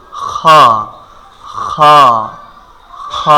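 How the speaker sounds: খ as a rasped velar fricative; Arabic kh, friction then open vowel; end-of-throat kha. Shown as a demonstration. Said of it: "হলকের শেষ হইতে".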